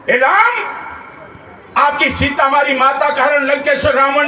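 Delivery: measured phrases with long dramatic pauses; dramatic story oration. Acted. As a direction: declaim a sacred tale to a crowd. Tells Ramayana story as oration.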